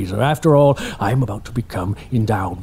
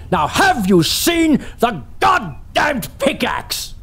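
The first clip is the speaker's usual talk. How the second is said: slow, high shouted demand, losing patience; angry shout; 9 semitones higher, slower than usual. Acted. Employer shouting at his assistant Casey, angry shout. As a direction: bellow the demand word by word.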